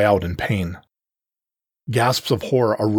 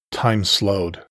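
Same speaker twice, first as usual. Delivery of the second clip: plain reading voice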